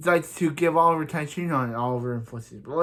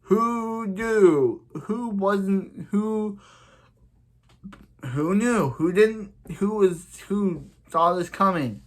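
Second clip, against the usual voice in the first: sarcastic who knew; 5 semitones higher, slower than usual. Real, not acted. Recapping the villain reveal to viewers, sarcastic and high. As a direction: sneer at the obvious twist.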